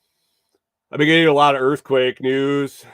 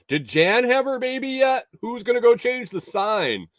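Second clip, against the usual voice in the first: small-town gossip; 11 semitones higher than usual. Pretend. Jokes as small-town resident.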